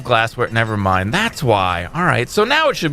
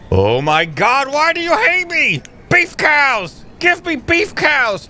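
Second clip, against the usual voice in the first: despairing demand; 9 semitones higher, slower than usual. Real, not acted. Player wailing at the supplier for beef cows. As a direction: cry out to the sky for cows.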